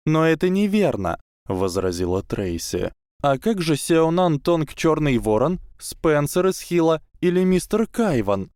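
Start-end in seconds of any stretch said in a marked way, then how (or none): 0.1-1.2 high, eager schoolgirl; bright proud young witch; girl voice. A narrator's reading. Tracey objects. 3.2-8.5 high, eager schoolgirl; bright proud young witch; girl voice. A narrator's reading. Tracey lists names.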